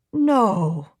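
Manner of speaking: put-on mild gasped no in a German accent; a touch of shocked disbelief; German woman gasping in disbelief. A narrator's reading. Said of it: "she gasped".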